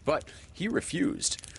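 ordinary delivery, nothing marked

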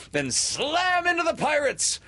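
high, gleeful battle cry; rallying cry. A narrator's reading. Peter orders his boys to attack, rallying cry. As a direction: send them charging with a high cry.

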